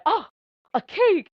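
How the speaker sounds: high, loud burst of delight; excited discovery. Retold or imitated. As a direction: squeal it out like a kid finding a treat. Larry discovers the cake.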